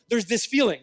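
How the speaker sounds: normal talking voice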